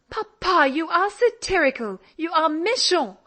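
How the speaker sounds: high, loud mock scolding; pert young girl. A narrator's reading. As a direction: scold him playfully, up high. Polly teasing her father back.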